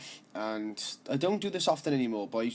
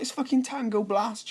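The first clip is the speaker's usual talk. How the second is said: cheeky reply; 11 semitones higher, 3 dB louder than usual. Retold or imitated. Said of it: "I was like".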